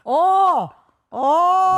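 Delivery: loud, high choking Bengali wails; drowning Bengali cries. Pretend. A friend hams up a drowning Bengali with loud high cries. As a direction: shout like a Bengali going under water. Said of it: "Was that a drowning Bengali?".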